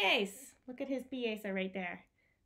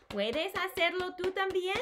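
put-on high, sing-song question to little kids; high playful read-aloud question; reading to children; 8 semitones higher than usual. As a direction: ask it brightly like a storybook refrain. Book's refrain to kids.

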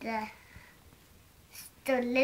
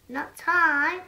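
shouted refusal; 4 semitones higher, 10 dB louder than usual. Acted. An animal refuses loudly.

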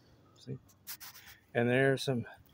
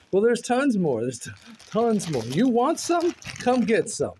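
teasing; 6 semitones higher, 5 dB louder than usual. Real, not acted. Teasing viewers with the goodies.

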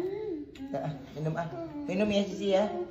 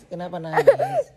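ordinary delivery

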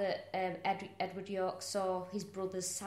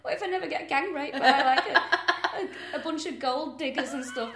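higher, fretful act breaking into louder laughter; laughing mock worry; 7 semitones higher, 8 dB louder than usual. Imagined runaway fears missing out, mock-worried and laughing. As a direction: fret in a higher voice, laughing through it.

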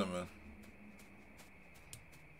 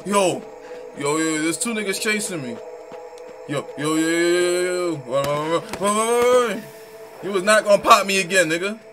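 frantic high panicked yelling; being chased; 6 semitones higher, 9 dB louder than usual. Real, not acted. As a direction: scream it while fleeing. Chased by two enemies.

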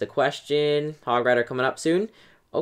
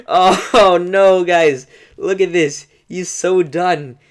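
gleeful laugh breaking into louder, higher words; real laughing, louder and higher; 4 semitones higher, 6 dB louder, much slower than usual. Real, not acted. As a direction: crack up over the win. Laughing at crushed opponent.

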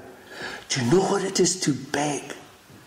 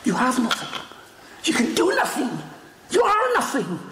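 building preaching; 11 semitones higher than usual. Acted. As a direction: climb step by step to the top. Preacher building three phrases.

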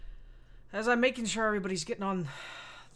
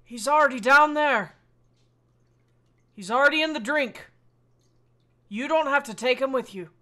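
loud, higher shout to the captain; raised-voice call; 4 semitones higher, 7 dB louder than usual. Acted. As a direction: call it out firmly over a distance. Shouting back at captain in role.

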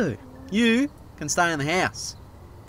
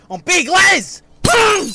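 much higher, much louder roar of his own name; psyched-up scream; 9 dB louder, much slower than usual. Acted. Les psyching himself up at the mirror, screaming. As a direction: scream it to pump yourself up. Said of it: "screaming and punching mirrors".

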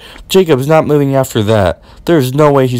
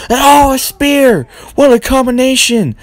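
excited call; 9 semitones higher than usual. Acted. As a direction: hit the spear call like a big-fight announcer. Calling the spear.

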